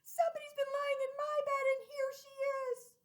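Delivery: very high shrill wee bear cry; little bear crying out. Acted. Baby bear discovering Goldilocks in her bed, shrill cry. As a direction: shriek it high and sharp. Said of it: "But then she cried".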